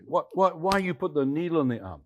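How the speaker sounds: high, loud broken-English telling-off; imitated scolding Chinese man. Man demanding why the stranger used a needle, loud imitated scolding. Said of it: "The young, um, Chinese, uh, man looked at me".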